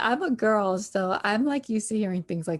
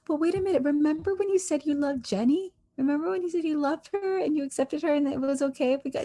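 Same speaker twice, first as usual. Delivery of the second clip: Sumit; 7 semitones higher, faster than usual. Retold or imitated. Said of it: "And Sumit's like".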